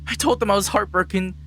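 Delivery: rising to a loud, high outburst; impassioned father. Acted. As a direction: declare it like a heartbroken soldier. Father explains his return.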